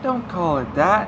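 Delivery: put-on high, loud girl's voice; protesting girl. Girl teased about her solo concerts, high protesting voice.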